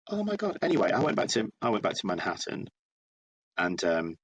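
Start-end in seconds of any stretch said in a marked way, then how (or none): 0.1-0.6 high gasp of disbelief; shocked. Retold or imitated. Learning his new friend dated the same boyfriend, high shocked squeal. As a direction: let it fly up, stunned by the news. Reacting to shared ex revelation.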